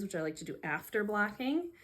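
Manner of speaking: normal talking voice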